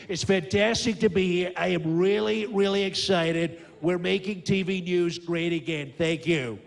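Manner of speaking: boastful American politician impression, drawing out key words; emphatic showman president with stretched superlatives; Donald Trump. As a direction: brag to the crowd in punchy, repeated phrases. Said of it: "now I've got Donald Trump".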